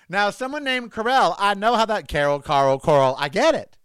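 normal talking voice

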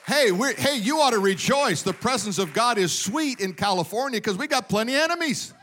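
ordinary talk, nothing marked

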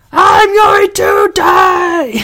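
slow, high screamed metal line. Shown as a demonstration. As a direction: scream it into a mic. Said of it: "Screaming in the microphone".

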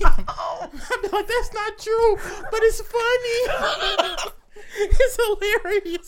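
high, slow, laughing through the words. Real, not acted. Man telling his friend about being cussed out, high laughing. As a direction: giggle it out, amused.

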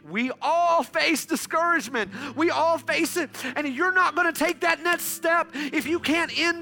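high, loud, driving sermon voice; preaching at full force. Acted. Preacher urging the church to end discouragement, fervent. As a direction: press the point home to the congregation.

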